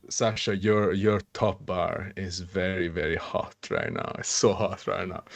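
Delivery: low, soft, hammed-up seductive; mock sultry. Mock sultry after talk-dirty request.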